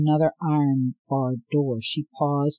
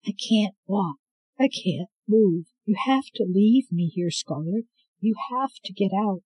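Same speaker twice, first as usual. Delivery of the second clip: a hint of a frail old woman, higher and pleading; lightly voiced grieving grandmother; 6 semitones higher than usual. Grandmother begging Scarlet to leave, lightly voiced and distressed.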